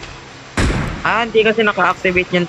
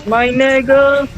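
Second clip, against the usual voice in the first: excited cheer; 9 semitones higher, much slower than usual. Real, not acted. Cheering teammate after a kill.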